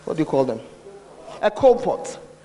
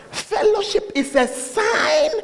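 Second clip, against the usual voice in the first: Fellowship stretched slowly, high then falling; slow emphatic stress; 8 semitones higher, slower than usual. Acted. Preacher stressing key point.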